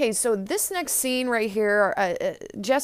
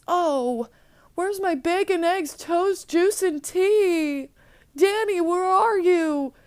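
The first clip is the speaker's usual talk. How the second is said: high-pitched, drawn-out calling out; Joey exclaiming; 8 semitones higher, slower than usual. Retold or imitated. Joey calls for Danny from another room, high exclaiming. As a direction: call out high for breakfast and for help. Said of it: "Joey exclaims".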